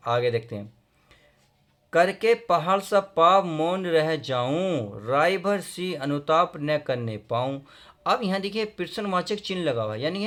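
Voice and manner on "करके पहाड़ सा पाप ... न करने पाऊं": slow, loud declaimed verse; poem recitation. Acted. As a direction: stretch each phrase of the couplet. Recites verse before explaining. (ऊँचे स्वर में धीमा कविता पाठ)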